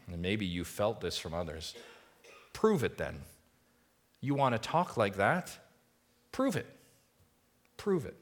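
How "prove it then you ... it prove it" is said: mocking taunt, leaping high on the last "Prove it"; scoffers taunting. Scoffers taunting Jesus to prove it, mocking. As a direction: jeer it like a doubter. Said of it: "scoffers ... in their heart saying".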